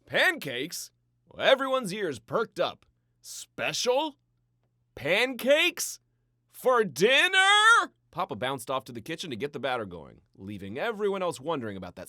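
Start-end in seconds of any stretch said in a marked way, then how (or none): 0.1-0.9 higher, louder eager question; perked up. A narrator's reading. The family reacting to pancakes, eager and higher. 3.2-7.9 rising questions, much higher and louder, slowing at the end; excited. A narrator's reading. The family firing questions at Papa, getting more excited.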